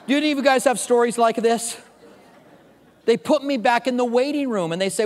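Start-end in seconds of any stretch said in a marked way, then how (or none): none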